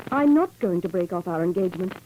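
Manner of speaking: ordinary delivery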